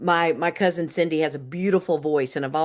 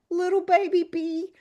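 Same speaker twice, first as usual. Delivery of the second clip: put-on high little baby voice; 12 semitones higher than usual. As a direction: say it like a tiny child. Naming the baby character.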